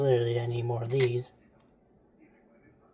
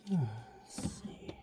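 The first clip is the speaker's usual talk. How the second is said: whisper; 2 semitones lower, 13 dB quieter than usual. Real, not acted. Muttering to self while building.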